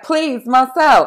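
loud, drawn-out mock plea; Ross from Friends. Ross begging Marcel in a meme, mock exasperated plea. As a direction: beg slowly and loudly. Quoting Ross pleading with monkey.

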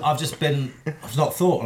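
ordinary talk, nothing marked

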